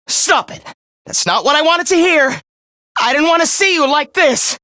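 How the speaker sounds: high, frustrated protest; upset outburst. Acted. Villain dismayed that Takumi gives up, upset outburst. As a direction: cry out as the prize is spoiled.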